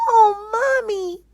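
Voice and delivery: high, loud tearful cry; a little girl wailing for her mother. Acted. Lisa crying to her mother over lost bear. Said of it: "she exclaimed".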